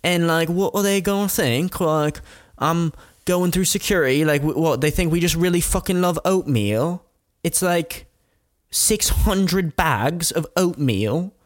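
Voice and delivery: high, hammed-up girl voice; mock Michaela. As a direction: imitate her disbelief about the oatmeal. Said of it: "She goes".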